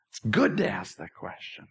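low, hushed reassurance; soft and low. Real, not acted. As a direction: drop the voice and say it quietly. Repeats reassurance, even softer.

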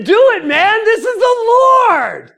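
high, loud cry with LORD drawn out; emphatic exclamation. Retold or imitated. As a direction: proclaim it like a thrilling revelation. Pastor's excited declaration retold.

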